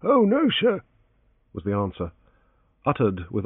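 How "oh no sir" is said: high, deferential servant voice; humble old valet answering his master; elderly valet Parker. A narrator's reading.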